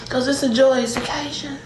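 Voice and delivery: ordinary talk, nothing marked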